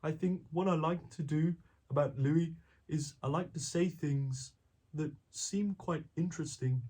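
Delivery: a light hint of Louis Theroux. A light Louis Theroux voice as he tells the interviewer about his favourites. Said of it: "a little bit of Louis Theroux".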